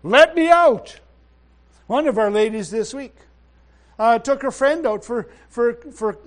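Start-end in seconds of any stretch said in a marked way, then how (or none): 0.0-1.0 loud, falling cry to be let out; inner voice crying. Acted. Brother hearing the Spirit while ministering, loud cry. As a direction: call out twice like something pressing to escape. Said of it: "he heard on the inside of him".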